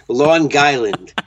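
slow "Long Guyland" with a hard G; drawn-out Long Island accent; New York accent. Shown as a demonstration. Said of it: "that's the New York accent".

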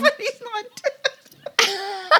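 high, breaking into laughter; laughing hard. Real, not acted. Host losing it at her own Lou Ferrigno joke. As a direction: lose it halfway through.

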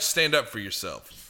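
ordinary delivery

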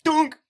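loud, high mock "tonk" of a bag hitting; hit sound. Imitating the handbag hitting the creep, loud mock smack. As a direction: smack the word out like a blow.

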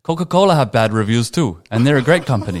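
parroting the scammer's excuse; a hint of the smug salesman; mock scammer. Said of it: "He goes".

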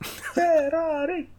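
slow, high wailing Ferrari; drawn-out kid's cry. Retold or imitated. Imitating the kid, wailing a long slow Ferrari. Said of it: "that's what that kid says".

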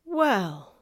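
hesitant, drawn-out word; rather slowly. A narrator's reading. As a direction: say it slowly, weighing your answer. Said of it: "said Lucy rather slowly".

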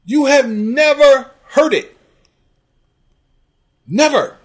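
loud, high, slow emphatic challenge; indignant rant. Real, not acted. Host challenging a commenter's claim about the coach, indignant.

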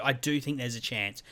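normal talking voice

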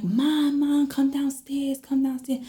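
high, urgent call up the stairs. Retold or imitated. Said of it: "you hear the urgency in my voice".

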